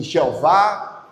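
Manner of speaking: "Jeová" drawn out, stressed on the final vá; suggested pronunciation. Shown as a demonstration. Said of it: "que pronúncia é essa".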